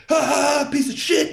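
high, loud, bratty taunt; mock jeering kids. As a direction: sneer it like rich brats driving past. Rich kids jeering from car.

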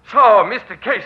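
loud and high, catching him out; spring the trap with a gloating call; triumphant. Acted. Catches spy at window.

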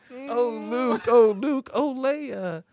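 high, loud mock lament; overacted wailing. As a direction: wail the names like a soap opera. Hammy lament while playing figures.

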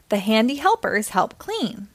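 ordinary delivery, nothing marked

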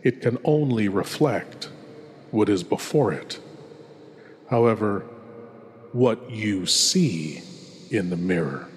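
ordinary talk, nothing marked

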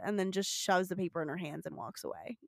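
ordinary talk

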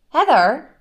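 high, loud earnest impression; earnest Shannon. Imitating housewife confronting her friend, earnest mock call. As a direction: call the name in wounded earnest. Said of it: "she does quite earnestly go like".

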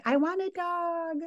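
put-on high, pleading kid's voice; child asking her mom for a dog, whiny and high. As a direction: beg for a dog like a little girl. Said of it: "Avi, like, all the time is like".

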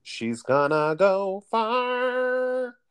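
a high, slow, theatrical echo; drawn-out mock prophecy. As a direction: croon it like a grand prediction. Echoing friend in drawn-out voice.

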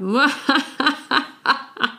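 evil laugh. Shown as a demonstration. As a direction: cackle like a cartoon villain. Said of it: "I'm so evil".

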